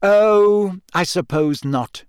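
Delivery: long high "Oh" falling into resignation; sighed. A narrator's reading. Hermione gives in to the boys, sighed. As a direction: sigh it out, giving in. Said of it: "she sighed".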